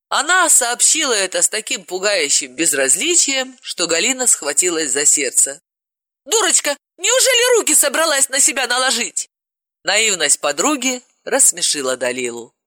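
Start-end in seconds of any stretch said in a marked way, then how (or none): 6.2-9.3 high, anxious cry; alarmed Galina. Acted. Galina fears Dalila will harm herself, high and anxious. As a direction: gasp it in alarm.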